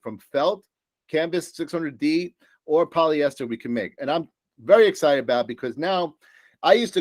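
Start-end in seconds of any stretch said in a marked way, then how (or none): none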